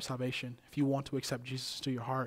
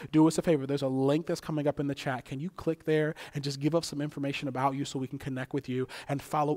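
loud, driving preacher's rally; energetic, projected call for praise; rousing church announcement; 6 dB louder than usual. Real, not acted. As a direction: hype up the congregation to celebrate. Preacher rallies church to applaud.

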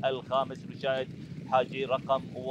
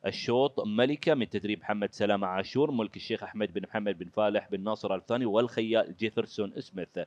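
lower, formal results announcement; booming prize announcer; 4 semitones lower, 3 dB louder than usual. Acted. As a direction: announce the winners with ceremony. Reads official results after race.

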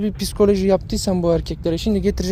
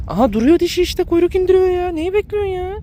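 high excited pointing-out; 10 semitones higher than usual. Real, not acted. Excited at the female's tail.

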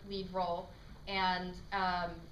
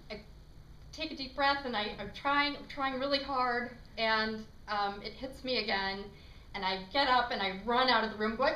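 ordinary talk, nothing marked

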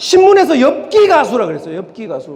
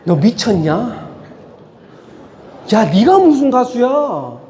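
mocking friend's scorn; 4 semitones lower, slower than usual. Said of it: "날 것 그대로".

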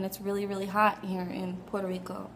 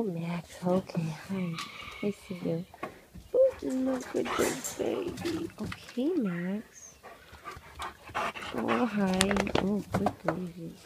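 cooing to pet; 4 dB quieter, slower than usual. Real, not acted. Cooing to sick dog.

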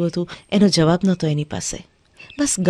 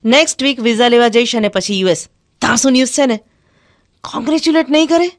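excited news; 5 semitones higher, 5 dB louder than usual. Acted. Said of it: "Shyama આતુરતાથી જોઈ રહી".

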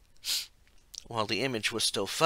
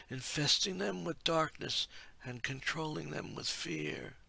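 higher, slow, softer prophetic voice; ethereal oracle voice; 6 semitones higher, 4 dB quieter, slower than usual. A narrator's reading. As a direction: speak like a hushed ancient seer. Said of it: "the ethereal voice of the oracle".

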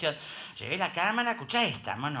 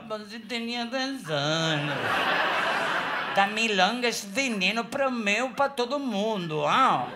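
mock Brazilian accent; 3 semitones higher, slower than usual. He mimics pastor's accent back.

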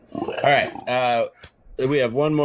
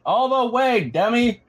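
raised teasing yell. Real, not acted. Dad shouting at kid.

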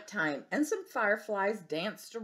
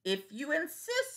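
higher cocky reply; yellow jacket; 8 semitones higher than usual. Acted. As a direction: answer coolly. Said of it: "said the yellow jacket".

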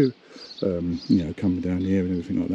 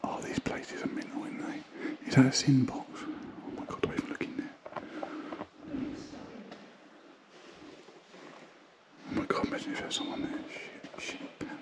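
hushed unvoiced whisper, much softer; 3 semitones higher, 12 dB quieter than usual. Real, not acted. Exploring the church with Andy, whispering. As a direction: keep your voice down in the church. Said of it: "Why am I whispering?".

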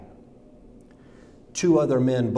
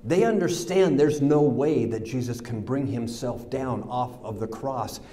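usual speaking voice

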